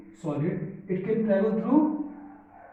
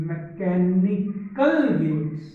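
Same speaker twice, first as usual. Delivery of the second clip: very slow, syllable-by-syllable term; emphatic dictation; 4 dB louder, much slower than usual. Real, not acted. Teacher dictating a key term, drawn out slowly. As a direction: stretch out each syllable for note-takers.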